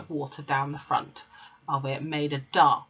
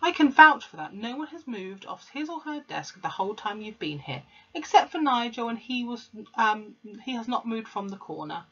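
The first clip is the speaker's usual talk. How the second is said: teacher vouching; 6 semitones higher than usual. A narrator's reading. Miss Honey defending class.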